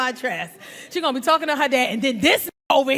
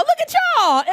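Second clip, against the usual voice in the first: mock gushing; 12 semitones higher, 4 dB louder than usual. Mocking matching married couple.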